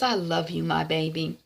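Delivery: soft, gentle mother's words; tender mother. Retold or imitated. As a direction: comfort her daughter softly. Retold mother's tender goodbye.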